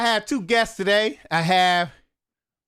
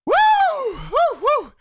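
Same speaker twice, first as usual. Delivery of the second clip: excited whoops; 10 semitones higher, much slower than usual. Real, not acted. Host whooping to open his live show, excited. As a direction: hype the crowd with big whoops.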